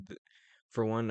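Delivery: ordinary talk, nothing marked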